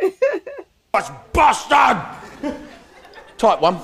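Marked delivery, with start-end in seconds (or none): 0.0-2.0 high, drawn-out Scottish bellow; hammed-up slow Scottish roar; coughing-cow Scotsman. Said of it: "sounds like a cow with a cough".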